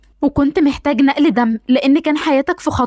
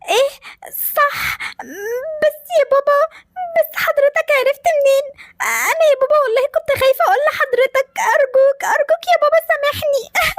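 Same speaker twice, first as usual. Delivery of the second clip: put-on high, tearful child begging through sobs; little girl crying and pleading; tearful plea; 11 semitones higher than usual. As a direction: beg your father for forgiveness while crying. Daughter begs father after being caught.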